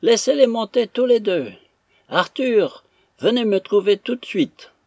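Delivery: high, loud commanding call; calling from upstairs. A narrator's reading. Invalid mistress upstairs summons Arthur, calling loudly. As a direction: project orders down the stairwell, pitched high. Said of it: "se fit entendre d'en haut".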